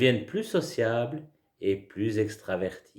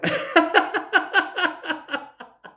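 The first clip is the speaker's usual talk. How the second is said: high laughter; 3 dB louder than usual. Shown as a demonstration. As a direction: burst of high giggling. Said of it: "et on y va". (grand éclat de rire aigu)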